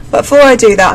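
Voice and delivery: normal talking voice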